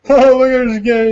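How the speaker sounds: high, loud mock jeer; hammed-up director jeering to the crowd. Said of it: "talking and joking with the crowd".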